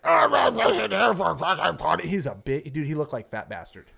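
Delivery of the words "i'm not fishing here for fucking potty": higher, garbled mock rant; obnoxious angler. Angler blustering back at twenty boats, obnoxious. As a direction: imitate a loudmouth blustering back. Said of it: "yelling at the guy".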